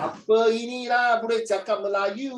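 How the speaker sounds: mock Malay. Tutor teasing the student about speaking Malay, in a mock Malay accent. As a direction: tease with a singsong Malay lilt. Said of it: "talk like Malay".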